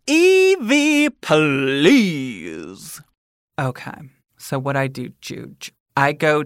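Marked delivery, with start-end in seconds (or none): 0.1-3.0 slow, high, loud ghostly announcer; unearthly voice. Acted. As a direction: draw it out like a spooky intro. Podcast segment intro, spooky theme.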